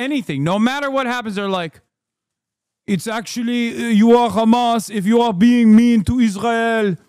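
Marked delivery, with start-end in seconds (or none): 2.9-7.0 loud sarcastic put-on official voice; mock the official, louder; mocking impression. Mocks officials' stock accusation.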